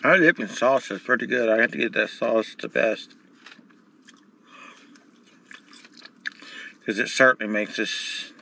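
eating between words; talking while chewing. Real, not acted. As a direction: mouth partly full of food. Seen 1: Bites food just before, hands in food tray while talking.

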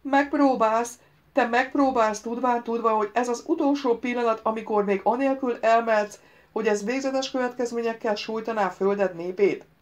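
high, loud incredulous reproach; outraged disbelief. A narrator's reading. Voice is outraged by the answer. (felháborodott, hitetlenkedő szemrehányás)

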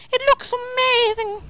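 high, gushing exclamation; excited awe. Real, not acted. Gamer marvelling at what he sees, high excited awe. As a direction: burst out with delight. Same remark repeated with excitement.

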